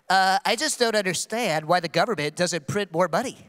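a lightly voiced, clueless ex-girlfriend; imitating ex-girlfriend. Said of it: "this is what her voice sounded like in my memory".

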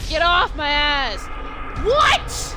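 loud, frustrated yell. Real, not acted. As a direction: shout at the game. Yelling at game.

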